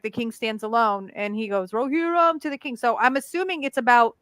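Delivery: usual speaking voice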